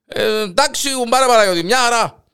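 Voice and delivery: loud, high shout back; calling back next door. Acted. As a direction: answer through the wall. Answering through the wall. (φωνάζει πίσω στον γείτονα)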